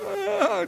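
high-pitched mock wail; imitated squalling. Storyteller imitating a crying woman at the motorhome, squalling. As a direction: put on a squalling cry, very high. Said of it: "just a squalling".

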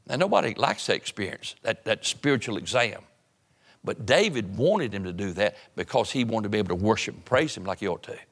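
ordinary delivery

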